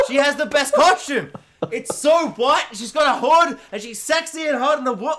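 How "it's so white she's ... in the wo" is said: ordinary talk, nothing marked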